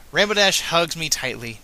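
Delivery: ordinary delivery, nothing marked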